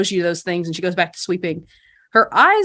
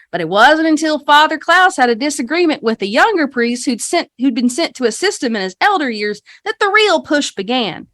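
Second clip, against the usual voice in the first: higher, lively, rehearsed guide patter; tour guide spiel; 6 semitones higher than usual. Acted. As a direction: recite a folksy town history to visitors. Game master voices guide's rehearsed spiel.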